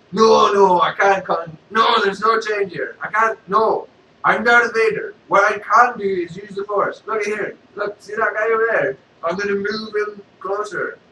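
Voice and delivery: loud, insistent mock Vader; Darth Vader. Vader refuses, shows the Force.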